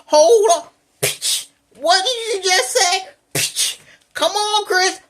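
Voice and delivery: high, loud mock scolding; hammed-up "hold on, sister" call-out; mocking the other side. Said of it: "They be like".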